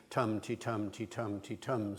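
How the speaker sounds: tum-ti-tum, alternating strong and weak beats; stress-timed rhythm. Shown as a demonstration. Lecturer illustrating traditional English rhythm, stress-timed. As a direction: beat out an even iambic tum-ti-tum. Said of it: "sort of rhythm".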